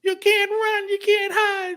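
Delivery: very high mock witch voice; wicked witch. Mocking her threat to the runaway, wicked witch voice. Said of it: "the Wicked Witch of the what".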